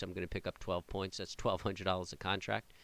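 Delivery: ordinary talk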